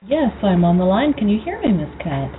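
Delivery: ordinary talk, nothing marked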